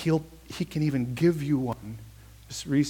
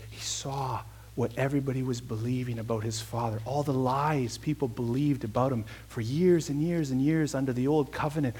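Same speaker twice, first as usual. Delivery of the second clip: usual speaking voice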